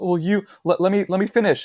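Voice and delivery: loud, high mock stammering; flustered. Bully answering the man who confronted him, flustered. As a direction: imitate a thrown bully stumbling to cut in. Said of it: "He just wasn't expecting it".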